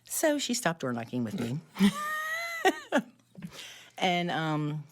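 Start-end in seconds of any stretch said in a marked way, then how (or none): 1.6-3.0 squealing high laugh. Real, not acted. Joke backfired, laughs.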